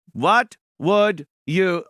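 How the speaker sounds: mock showman talking slowly to a robot; slow deliberate talk. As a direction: stretch it out so the robot can follow. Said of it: "he's gotta talk slow so the robot understands".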